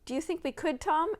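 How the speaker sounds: hesitant, unsure; doubtfully. A narrator's reading. Sister doubtfully asking her brother. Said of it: "asked Caddy doubtfully".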